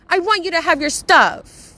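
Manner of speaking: loud, high, furious ranting at a friend; furious rant at the best friend over her stuff; angry rant. Real, not acted. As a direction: yell it out, fed up and exasperated.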